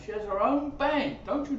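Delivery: normal talking voice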